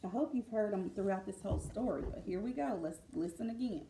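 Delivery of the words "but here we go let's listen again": usual speaking voice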